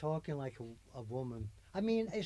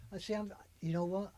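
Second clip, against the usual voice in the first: usual speaking voice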